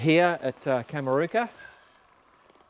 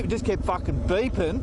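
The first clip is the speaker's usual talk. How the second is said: normal talking voice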